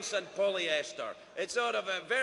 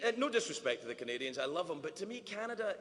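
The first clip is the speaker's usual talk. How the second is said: normal talking voice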